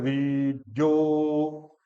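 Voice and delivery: री and जो held very long, slow. Shown as a demonstration. Teacher draws out री and जो slowly. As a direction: stretch री, जो out. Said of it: "री बड़ा है".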